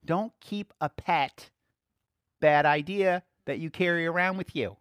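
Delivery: ordinary delivery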